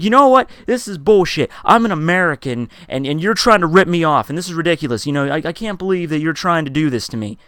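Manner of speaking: mock angry rant. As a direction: imitate a customer ranting in outrage. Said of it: "he's starting to rant at me".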